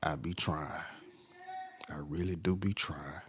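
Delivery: usual speaking voice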